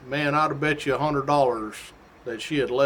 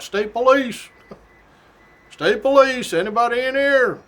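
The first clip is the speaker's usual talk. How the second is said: loud, high announcing shout; real loud; 8 semitones higher, 4 dB louder than usual. Shown as a demonstration. Trooper entering a house, shouting to announce himself. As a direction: call it out loud so anyone home hears. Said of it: "real loud".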